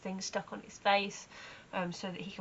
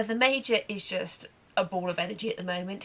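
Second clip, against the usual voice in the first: ordinary talk, nothing marked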